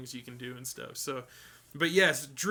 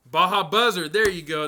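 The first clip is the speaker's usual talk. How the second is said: excited; 3 semitones higher, 11 dB louder than usual. Real, not acted. Suddenly remembers the name.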